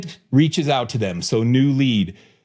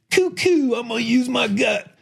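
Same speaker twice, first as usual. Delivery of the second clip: mock a gut-driven Southern hillbilly; hammed-up Southern hillbilly accent; 8 semitones higher than usual. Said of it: "Do you like my Southern, uh, hillbilly accent?".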